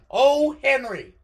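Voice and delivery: loud, slow and exasperated; exasperated groan. Real, not acted. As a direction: drag it out loudly in real frustration. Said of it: "I'm dying".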